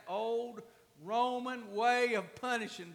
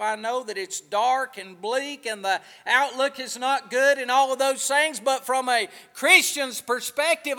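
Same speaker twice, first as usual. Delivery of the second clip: loud, fervent preacher's call to the church; preaching; 12 dB louder than usual. Acted. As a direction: preach it out loud and full of fire. Preaching at full force.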